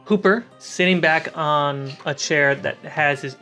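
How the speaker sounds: ordinary delivery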